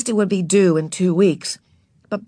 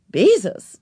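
surprised exclamation; 5 semitones higher, 6 dB louder than usual. Acted. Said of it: "exclaimed the lady".

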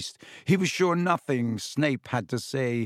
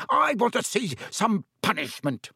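high, shrill-raised demand; shrieking; 9 semitones higher than usual. A narrator's reading. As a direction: shriek it out, higher. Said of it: "he shrieked".

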